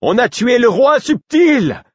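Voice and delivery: high, loud and slow lament; crying out. A narrator's reading. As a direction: announce the murder to everyone. Voice crying out in the fight.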